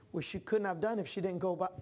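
ordinary talk, nothing marked

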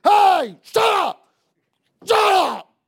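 full-force high husband's yell; furious yelling. Acted. Husband acted out yelling at his wife, furious shouting. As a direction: roar orders at the top of your voice. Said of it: "he was yelling at his wife".

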